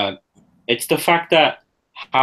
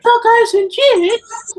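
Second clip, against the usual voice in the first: put-on much higher, slow little kid's voice; 7 dB louder, much slower than usual. Imitating the kid from the TV show, high and slow. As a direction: squeak it out slowly like a small child.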